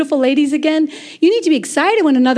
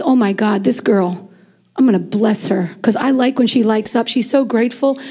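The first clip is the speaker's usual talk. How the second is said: low-pitched fond musing; God, pleased; 5 semitones lower than usual. Acted. God talks about a grateful girl, low and fond. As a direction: play a pleased God in a lowered voice. Voices God speaking fondly of her.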